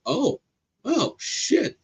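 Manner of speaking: high, surprised recognition; acted-out amazed swearing; stunned realization. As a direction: let it dawn on you, pitch leaping up. Re-enacting realization after Googling him.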